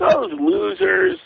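high, slow sneering jab; mocking sneer. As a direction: drag it out with mock disdain. Mocking the fans' dismissal.

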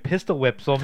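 ordinary talk

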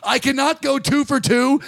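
ordinary talk

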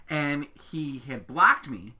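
normal talking voice

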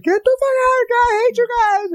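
very high shriek; wife freaking out. Retold or imitated. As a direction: shriek in panic to chase them off. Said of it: "She was like".

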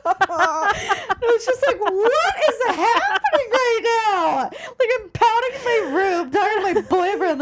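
high, slow words through real laughter; can barely get it out for laughing. Real, not acted. Telling a friend the story, cracking up laughing.

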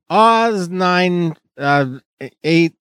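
slow, hesitant guess at the price; unsure, stumbling over the number; stammering. Shown as a demonstration. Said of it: "you're stammering like that".

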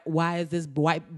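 ordinary talk, nothing marked

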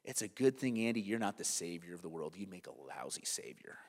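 low, soft, solemn rebuke; quiet divine correction, imitated; hushed voice of the Lord. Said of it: "the voice of the Lord said".

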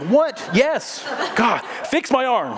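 a high, stretched, incredulous plea; mock disbelief. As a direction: act out the disbelief, then slowly beg God. Mock reply while in pain.

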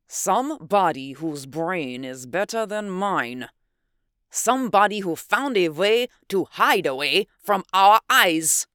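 put-on heavy German accent under emotional stress; agitated German-accented scientist; Teutonic accent. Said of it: "His Teutonic accent grew more pronounced".

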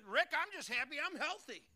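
I'm happy I'm healthy lightly voiced; mock congregant.

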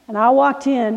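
usual speaking voice

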